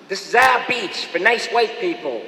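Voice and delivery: mock screech, Boston accent; screeching Boston protester. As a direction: bark it out like a busing-era Boston mother. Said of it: "would be screeching about".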